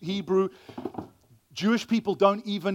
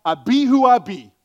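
emphatic declaration; 2 semitones higher, 8 dB louder than usual. Retold or imitated. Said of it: "it would be like".

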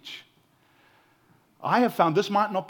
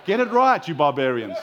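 mock scolding; 9 dB louder than usual. Preacher correcting congregation over paella, loud mock scolding. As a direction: jokingly berate the crowd.

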